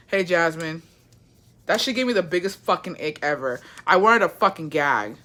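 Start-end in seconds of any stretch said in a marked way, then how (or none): none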